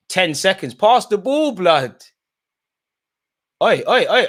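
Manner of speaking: loud, high call to pass; urgent shout at the screen; yelling at players. Real, not acted. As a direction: bark at the team to pass it. Yelling at players, final seconds.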